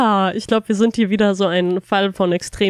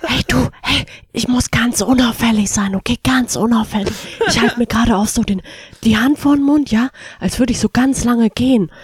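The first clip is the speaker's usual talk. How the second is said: whispering on the phone; 3 semitones higher than usual. Pretend. Secretive phone call acted out.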